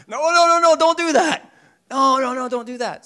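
high, loud frantic no no no; frantic stopping. Acted. The young men trying to stop Abraham, shouting high.

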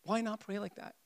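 usual speaking voice

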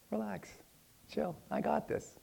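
very soft, hushed calming voice; gentle reassurance. Retold or imitated. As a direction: soothe him softly, word by word. The Lord reassuring him.

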